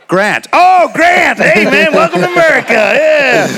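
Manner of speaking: loud, high, drawn-out American welcome; hammed-up gushing American, "Grant" with a flat short a; whooping mock-American recognition; mock American accent. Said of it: "And I'm like".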